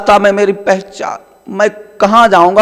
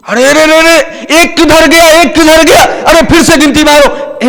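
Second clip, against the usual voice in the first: panicked alarm; 6 semitones higher than usual. Acted. Friend realises one is missing, high panicked cries.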